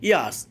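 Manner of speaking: ইয়ার্স with the R left silent. Shown as a demonstration. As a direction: say ইয়ার্স as "yaas", no R. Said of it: "তাই আর এর উচ্চারণটা হয়নি".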